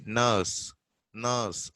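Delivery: "nurse" said with a silent R, no R sound. Shown as a demonstration. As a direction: drop the R in "nurse". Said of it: "R silent".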